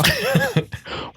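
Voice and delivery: a sudden high-pitched burst of laughter; laughing up high in amusement; cracking up at the reply. Real, not acted. Amused by friend's wow.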